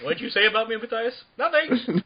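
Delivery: high, loud offended abbot voice; indignant abbot. Pretend. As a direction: bark it like a touchy old abbot. Abbot confronts Matthias, mock scene.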